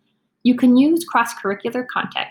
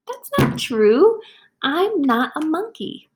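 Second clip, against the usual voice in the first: indignant protest; 7 semitones higher, slower than usual. Pretend. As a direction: insist it isn't true. Reader protests.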